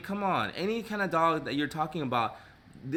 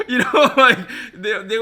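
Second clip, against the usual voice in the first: loud high laugh through the words; 8 semitones higher, 13 dB louder than usual. Real, not acted. Laughing hard at her own salon joke. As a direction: burst out laughing.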